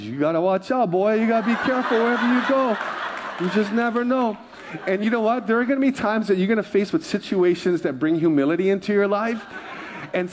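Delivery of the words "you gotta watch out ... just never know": lightly voiced mom, rising higher; hint of a cautioning mother; warning mother. Mom warns her son, lightly imitated caution. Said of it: "She goes".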